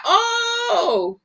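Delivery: high wavering crying "Oh"; mock sob. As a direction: whimper it like you're about to cry. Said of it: "I was like".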